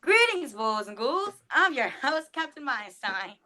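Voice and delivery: theatrical vintage horror host greeting; Captain Meinstein voice. Acted. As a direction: ham it up like an old-school horror host. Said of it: "Are you gonna do your Captain Meinstein voice for us?".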